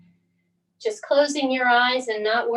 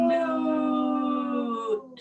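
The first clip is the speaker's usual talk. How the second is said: mock moo. Mooing at the moon in yoga.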